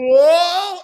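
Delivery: high, loud shout of surprise; startled whoa. Retold or imitated. The guys startled by the werewolf silhouette. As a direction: gasp it out as the wolf appears. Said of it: "the guys are like".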